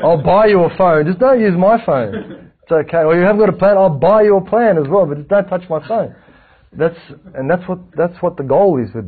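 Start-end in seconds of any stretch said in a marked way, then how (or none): 0.0-6.1 higher, louder, slowed pleading; mock pleading. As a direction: beg them, half joking, to leave the phone alone. Pleading with phone borrower.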